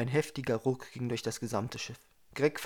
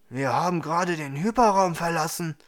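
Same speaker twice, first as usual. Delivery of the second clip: louder, startled announcement; surprised; 4 semitones higher, 8 dB louder than usual. A narrator's reading. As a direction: blurt it out in astonishment. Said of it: "hörte er Marquardt überrascht sagen".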